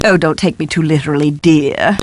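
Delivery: ordinary talk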